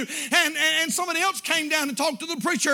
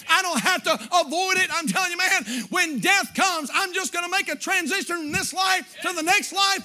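loud, rising preacher's cry; fiery sermon climax; full-force preaching; 4 semitones higher, 3 dB louder than usual. Acted. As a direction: preach it out to the pews.

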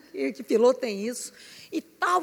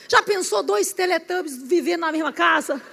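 ordinary delivery

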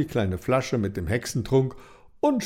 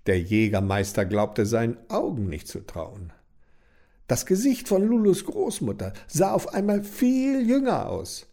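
ordinary delivery, nothing marked

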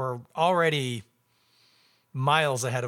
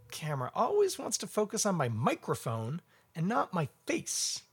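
usual speaking voice

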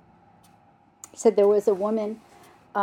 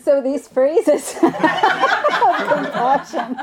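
real laughter breaking into the words; high, loud, cracking up while talking; laughing; 8 semitones higher, 9 dB louder than usual. Real, not acted. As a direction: try to carry on through laughter. Cracking up after her joke.

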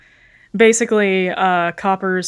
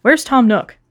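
stern dog detective; 5 semitones higher, 5 dB louder than usual. Acted. Copper demands Nook.

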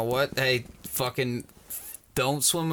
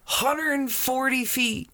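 amazed; 10 semitones higher, slower than usual. Real, not acted. Marvelling at pool size.

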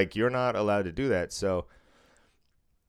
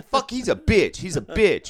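higher, louder imitated sneer; mock angry insult; 7 semitones higher, 7 dB louder than usual. Big guy insulting the worker to his buddy.

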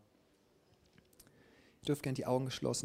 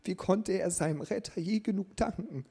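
höher und lauter, innig bewegt; 7 semitones higher, 6 dB louder than usual. A narrator's reading. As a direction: steigere dich voller Rührung. Tom moved, breaking down crying. (hoch und laut vor Ergriffenheit)

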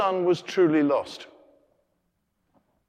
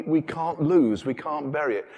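ordinary delivery

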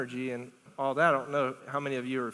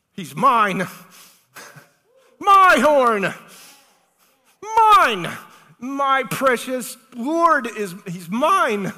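loud, high, drawn-out cry of ownership; possessive exultation; 9 semitones higher, 11 dB louder, much slower than usual. Acted. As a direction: claim it as yours at a rising shout. Acts out Hannah's possessive exultation.

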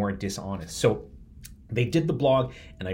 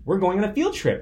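put-on higher, bright little-girl excitement; excited daughter; 8 semitones higher, 4 dB louder than usual. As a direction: voice his daughter bubbling over about the trip. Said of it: "my daughter wakes up and she's like".

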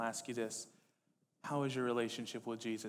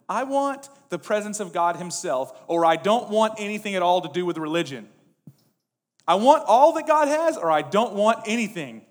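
building to a high, full-voiced shout; impassioned demand; 8 semitones higher, 16 dB louder than usual. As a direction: declaim it as an uncompromising cry. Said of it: "One's like".

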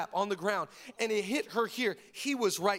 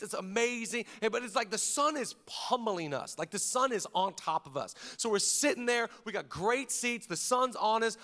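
ordinary delivery

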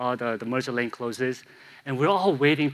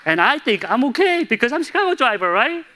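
pitched way up and louder, laughing boast; excited road-rage boast; 7 semitones higher, 6 dB louder than usual. Real, not acted. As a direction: crow about owning the highway, voice climbing. Joking about his road rage.

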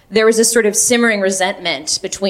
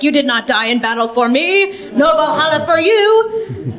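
booming Odin; 7 semitones higher than usual. Acted. Odin turning away a dead unbeliever, mock-thunderous. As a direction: pass judgement as a comic Norse god. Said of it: "it's Odin up there, and he's like".

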